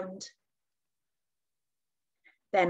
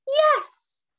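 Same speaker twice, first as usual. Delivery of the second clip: high, loud storybook witch's cry; 4 dB louder than usual. A narrator's reading. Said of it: "cried the witch".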